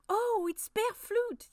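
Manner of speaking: high, loud, drawn-out exclamation; excited cry. A narrator's reading. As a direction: cry out in delighted recognition, stretching the words. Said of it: "Yasmina cried".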